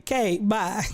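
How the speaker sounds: ordinary talk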